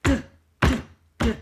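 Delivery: loud clipped "Duh" like a stomp; big dinosaur stomp sound; crisp d sound. Shown as a demonstration. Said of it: "you're gonna make this sound with me".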